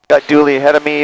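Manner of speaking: ordinary talk, nothing marked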